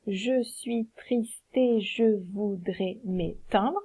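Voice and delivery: slow, syllable by syllable, whispered; slow scansion. Shown as a demonstration. Teacher scans the line for her class, slowly. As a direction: count out each syllable slowly. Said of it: "Donc ça donne".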